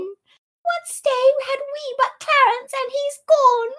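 put-on squealing high, loud child's wail; children. Children lamenting Clarence, mock shrill wailing. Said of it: "the children".

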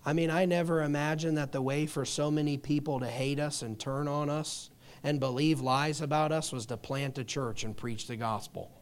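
ordinary talk

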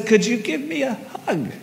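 soft, high, fragile request; imitating a quiet, high-pitched plea; small plea. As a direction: ask meekly for comfort. Distraught woman asks softly.

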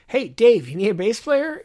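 mock-helpful smart-ass jab. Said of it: "I put some smart-ass comment up like".

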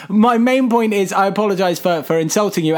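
ordinary talk

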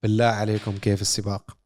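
ordinary delivery

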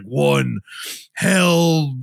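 imitated guttural possessed growl. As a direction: do a demon's guttural countdown. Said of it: "guttural voice".

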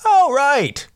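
sudden high pitched realization; oh right recognition. Retold or imitated. Listener recognizing the actor, high realization. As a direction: spot the face and catch it.

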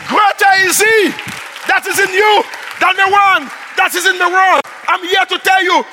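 loud, high proclaiming; fiery preaching. Acted. Pastor proclaiming sickness and fear are dead, full-force preaching. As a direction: declare it over the congregation.

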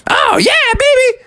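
put-on very high little-kid squeal; high-pitched gleeful cub; thrilled Junior Bear. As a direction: whoop with carefree glee. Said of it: "except for Junior Bear".

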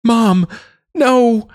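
put-on boy screaming as his mother is struck; roaring no. A narrator's reading. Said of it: "Damien roared".

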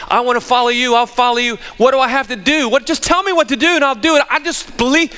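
put-on the rich young man begging Jesus, eager and high; eager rich kid. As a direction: gush like a kid desperate to please. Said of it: "this young rich kid".

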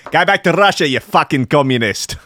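put-on South African accent. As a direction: shout it like a South African heckler. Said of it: "maybe even a South, uh, South African".

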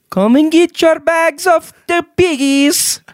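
street hawker. Pretend. Street seller calling out wares.

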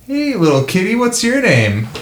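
high, lilting kitty talk; sing-songy voice. Pretend. Player greeting a little kitty, high sing-song voice. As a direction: coo at a cat in sing-song. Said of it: "in a sing-songy voice".

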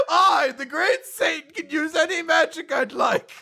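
high, loud, grandiose devil; Satan's mock boast. Host voicing the great Satan boasting, hammy villain. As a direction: proclaim it with pompous villain swagger.

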